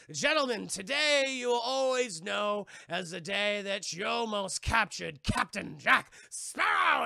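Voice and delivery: loud, slow, grandiose announcement; mock proclamation. Streamer acts out Jack's boast to the gentlemen, grand proclamation. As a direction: proclaim it to all, drawing out each word.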